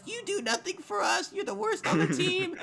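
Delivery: high, loud, laughing mock rant; angry rant. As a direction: shriek insults through laughter. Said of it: "And she's like".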